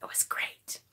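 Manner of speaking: sarcastic whisper; whispered sarcasm. Real, not acted. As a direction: breathe it out as a wry aside. Sarcastic aside about boss.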